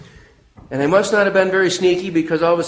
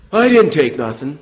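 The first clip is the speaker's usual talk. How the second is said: defensive denial; 2 semitones lower than usual. Retold or imitated. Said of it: "he had an attitude".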